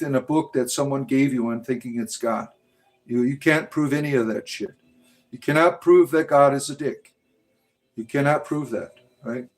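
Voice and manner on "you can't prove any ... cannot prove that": usual speaking voice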